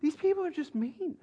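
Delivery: complaining son. Retold or imitated. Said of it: "his mom came to visit him".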